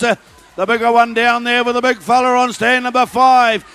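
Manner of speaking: usual speaking voice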